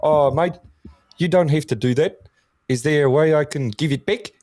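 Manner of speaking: high, sweet Maori New Zealander; adorable Maori accent. Retold or imitated. Retelling a woodworker thanking his donor, adorable high Maori accent. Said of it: "he was just so adorable".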